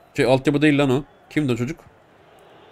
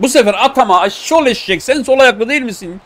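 frustrated yell; 8 semitones higher, 4 dB louder than usual. Real, not acted. Gamer yelling at own striker.

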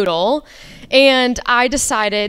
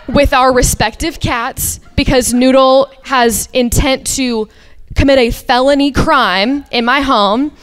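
ordinary delivery

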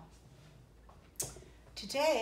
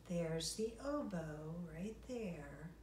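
whisper; 4 semitones lower, 6 dB quieter, slower than usual. Real, not acted. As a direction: softly whisper it pointing. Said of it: "aside to kids".

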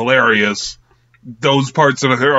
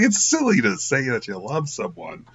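doofus voice; 5 dB quieter than usual. Retold or imitated. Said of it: "how much of a doofus he is".

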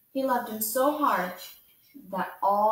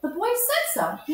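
high, loud pleading protest; a toy rabbit close to tears insisting he is real; near tears; 13 semitones higher, 6 dB louder than usual. A narrator's reading. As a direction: insist desperately, on the edge of crying. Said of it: "he nearly began to cry".

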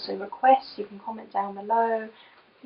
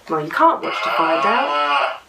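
ordinary delivery, nothing marked

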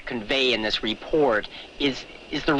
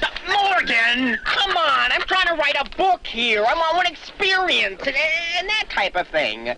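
exasperated plea; 12 semitones higher than usual. Acted. Writer begging Morgan to stop joking, exasperated. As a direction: come on, get serious with me.

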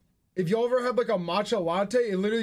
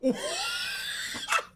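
shrieking laugh; 3 dB quieter than usual. Real, not acted. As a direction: burst into a shrill laugh. Laughs at toy candy.

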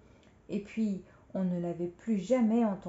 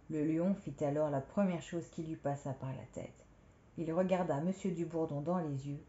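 plain reading voice

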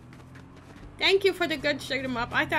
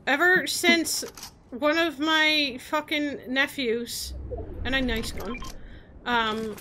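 ordinary talk, nothing marked

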